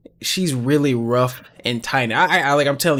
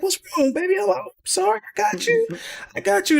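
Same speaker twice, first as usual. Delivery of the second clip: much higher soft cooing; soothing baby; 4 dB quieter, faster than usual. Retold or imitated. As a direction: hush her gently while rocking.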